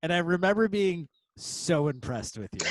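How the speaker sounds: ordinary delivery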